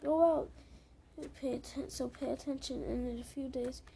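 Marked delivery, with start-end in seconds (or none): 0.0-0.5 much louder, higher "Oh, wow"; mock amazement. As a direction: gasp out the wow in pretend awe. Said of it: "you'll be like".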